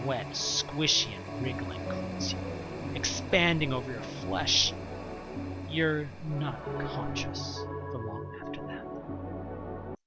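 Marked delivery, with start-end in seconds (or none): 5.7-10.0 slow, hushed narration; slow right down and soften as consciousness slips; fading out. A narrator's reading. Narrator tells the player they're blacking out, slow and hushed.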